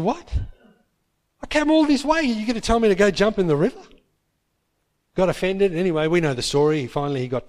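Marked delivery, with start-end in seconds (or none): 0.0-3.8 high, indignant and incredulous; you came all this way for this?; a general insulted by the cure, voice shooting up in disbelief; outraged disbelief. Retold or imitated. Naaman offended by healing instruction.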